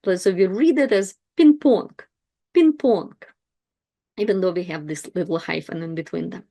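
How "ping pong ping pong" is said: ping-pong joined up with no break, "pin-PONK"; run as one word. Shown as a demonstration. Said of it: "they really build one word".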